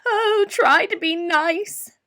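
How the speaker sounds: high, slow, scared whimper; whimpering lion. A narrator's reading. Frightened lion begging the mouse not to hurt him. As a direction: plead like a terrified big cat. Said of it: "he whimpered".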